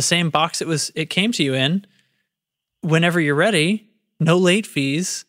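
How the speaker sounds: sing-song upbeat ad voice; lilting salesman ad read; ad read inflection. Acted. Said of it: "Can you not get out of this inflection?".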